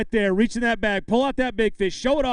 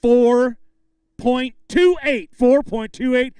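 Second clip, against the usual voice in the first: loud, slow, drawn-out number reveal; weight reveal; 4 semitones higher, 8 dB louder, slower than usual. Real, not acted. Emcee revealing the big fish weight, loud and drawn out. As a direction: build suspense, then land each digit.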